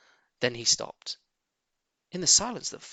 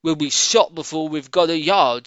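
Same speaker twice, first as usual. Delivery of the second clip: Badger; 6 dB louder than usual. Badger voiced in story.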